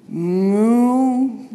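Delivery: hammed-up cow moo; cow bellow. A narrator's reading. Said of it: "bellowed the cows".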